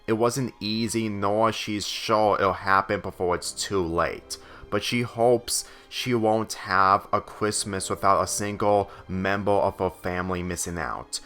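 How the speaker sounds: usual speaking voice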